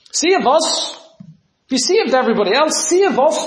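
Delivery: high, mocking plea to be saved; taunting thieves. Thieves mocking Jesus from their crosses, high jeering voice. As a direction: jeer it up high like a scornful thief.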